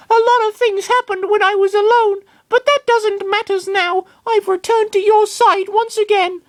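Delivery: earnest and squeaky, loyal to his trainer; high Lucario voice. Acted. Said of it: "Lucario said".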